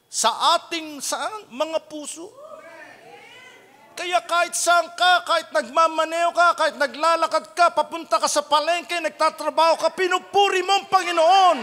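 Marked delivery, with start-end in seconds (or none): 4.0-11.6 raised, high voice building to a shout; building preacher's exhortation. Real, not acted. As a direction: drive the list up to a loud peak.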